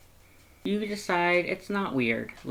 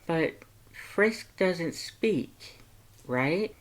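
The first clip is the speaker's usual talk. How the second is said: higher, lighter heroine voice; curious young woman; 5 semitones higher, much slower than usual. Acted. Heroine asking Sans about Papyrus's call, higher and curious. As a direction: ask Sans gently, curious.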